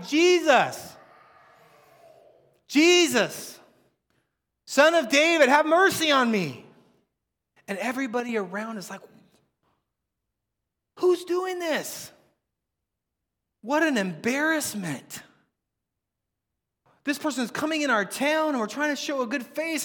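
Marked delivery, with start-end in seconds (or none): 0.0-6.6 loud, high desperate cry for mercy; high pleading yell, falling at the end. Acted. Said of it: "you just start yelling out". 11.0-19.8 high, scandalized townsperson; acted public embarrassment; indignant crowd. Indignant crowd reacting to beggar's yelling.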